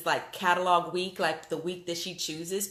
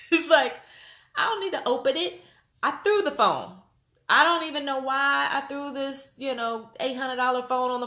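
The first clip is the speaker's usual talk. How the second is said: excited; 6 semitones higher than usual. Real, not acted. Excited retelling, phone thrown.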